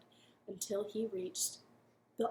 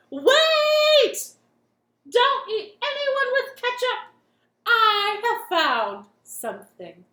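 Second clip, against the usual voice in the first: loud, high shout of wait; urgent shout; 11 semitones higher, 14 dB louder, slower than usual. Acted. Third chef bursting in to stop the king. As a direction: burst in yelling to stop them.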